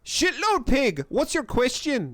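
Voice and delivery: put-on bratty street kid in a terrible accent. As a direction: spit it out in a badly faked accent. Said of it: "That's a terrible accent".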